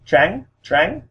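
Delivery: Trắng starting between English TR and CH, rising high; blended TR sound. Shown as a demonstration. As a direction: blend T-R with C-H and lift each trắng. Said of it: "blend those two sounds".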